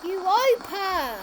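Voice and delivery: Europa! called out high and loud; calling out. Real, not acted. Child calling out Europa loud and high. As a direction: shout the name Europa. Child calls out moon name.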